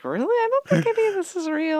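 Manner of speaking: very high-pitched doubting squeak; mock disbelief. Acts out own sceptical reaction.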